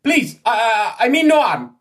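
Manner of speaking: high, loud panicked plea; alarmed foreigner crying out; startled pleading. Acted. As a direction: beg them not to shoot, voice way up. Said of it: "his eyes widen".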